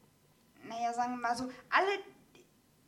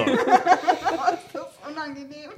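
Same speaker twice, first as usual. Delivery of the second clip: laughing; 2 semitones higher, 9 dB louder, much slower than usual. Real, not acted. Laughing, asking about the sneeze.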